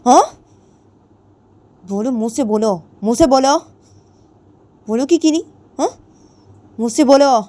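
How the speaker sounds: loud, high harsh commands; angry scolding. A narrator's reading. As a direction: bark at her to speak up. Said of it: "जिया बोलती है". (गुस्से में ऊँची, तेज़ आवाज़ में हुक्म देती)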